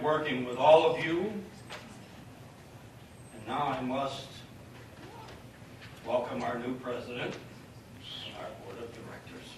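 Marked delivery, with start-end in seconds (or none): 3.3-9.5 teary. Real, not acted. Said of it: "I have tears in my eyes".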